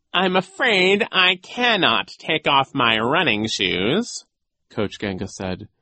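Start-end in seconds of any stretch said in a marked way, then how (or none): 0.1-4.2 slow, loud, high character voice; villain's polite refusal. A narrator's reading. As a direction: refuse with drawn-out mock regret.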